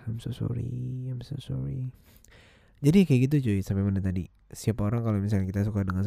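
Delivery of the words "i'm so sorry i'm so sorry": soft, slow sorry; quiet apology. Real, not acted. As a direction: murmur an apology.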